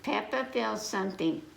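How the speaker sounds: plain reading voice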